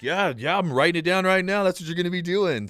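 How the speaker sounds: high, loud salesman patter; hammed-up pushy recruiter; mock eager recruiter. Recruiter signing up the teen, mock eager salesman. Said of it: "And he's like".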